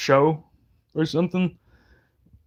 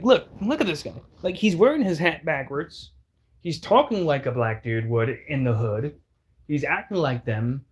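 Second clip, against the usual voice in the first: ordinary talk, nothing marked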